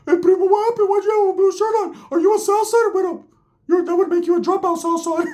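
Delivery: very high, squeaky mock voice; high cartoon voice imitation; Ricky's voice.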